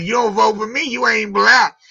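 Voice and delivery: lightly voiced Joe Biden; a hint of Biden's voice; imitating Biden. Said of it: "like Joe Biden said".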